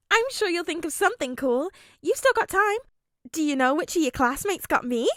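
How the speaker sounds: put-on high, bright bubbly girl; cheerful girl voice. A narrator's reading. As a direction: chirp it brightly. Nejire cheerful.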